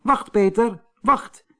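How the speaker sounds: high urgent call; little man calls after Peter, high and pressing. A narrator's reading. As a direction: stop him before he leaves. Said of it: "riep het kleine mannetje".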